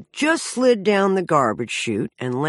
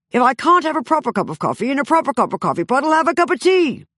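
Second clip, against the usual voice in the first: rattled off faster and faster, climbing higher; a drama school diction drill that speeds up and rises to a high finish; tongue twister drill; 4 semitones higher, 3 dB louder, faster than usual. Shown as a demonstration. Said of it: "learning weird little tongue twisters like".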